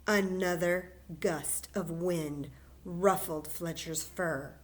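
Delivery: plain reading voice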